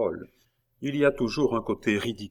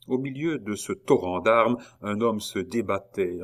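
usual speaking voice

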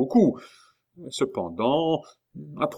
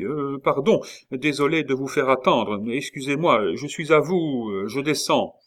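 raised, eager apologising; flustered apology; 6 semitones higher, 5 dB louder than usual. A narrator's reading. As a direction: apologise profusely to someone important. Officier confus, pressé de servir Chicot. (excuses empressées, voix plus haute)

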